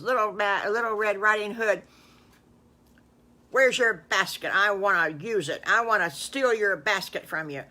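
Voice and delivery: put-on the greedy wolf barking demands at the girl; loud storybook villain; big bad wolf.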